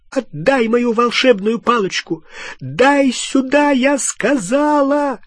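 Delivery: high, furious yelling, slowing to hammer it; frenzied demand. Acted. Hermione demanding her wand from Harry, frenzied yelling. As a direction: shriek the order as if possessed. Гермиона безумно требует палочку.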